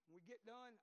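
ordinary talk, nothing marked

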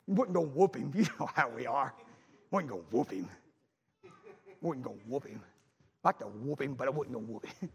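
very low, soft grumble; muttered aside. Retold or imitated. Father confiding he would not punish his son, muttered. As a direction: drop right down low and mutter it.

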